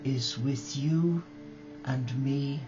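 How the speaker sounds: ordinary talk, nothing marked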